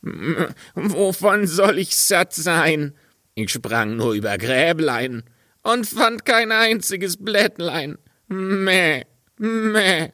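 high, bleating goat voice, drawing out the Meh. Acted. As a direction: play the cheeky goat bleating back. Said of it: "Und das boshafte Tier antwortete". (hohe, meckernde Ziegenstimme)

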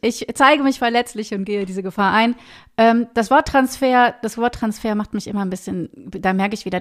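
ordinary delivery

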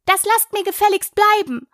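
high, sharp cry of protest; indignant shout. A narrator's reading. Alice yells out at those throwing pebbles, indignant shout. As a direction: cry out high to make them stop. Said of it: "und schrie hinaus".

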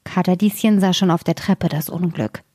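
ordinary delivery, nothing marked

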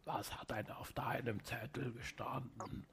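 grinding stone gravel voice; deep voice. Pretend. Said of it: "Sprich ab jetzt fünfzehn Minuten mit tiefer Stimme".

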